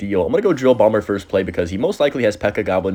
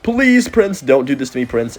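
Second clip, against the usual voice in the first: desperate plea; 6 semitones higher, 4 dB louder, slower than usual. Real, not acted. Begging the enemy Prince.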